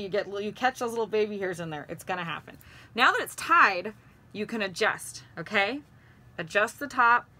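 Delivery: muffled cloth-covered speech; talking behind fabric mask; through a face mask. Real, not acted. Seen 1: Cloth mask covers mouth and nose throughout; reference frames unmasked.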